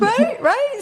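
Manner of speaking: high, giggly laugh through words. Real, not acted. Laughing at the Target joke.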